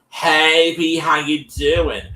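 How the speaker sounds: high, loud, drawn-out friendly hello; Susan calling B. Retold or imitated. Susan calls B up, high bright hello. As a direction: sing out the greeting like an eager caller.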